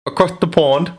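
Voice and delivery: badly faked British accent, pitched high; mock a British accent in a high questioning voice; terrible British accent. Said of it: "That was a terrible accent".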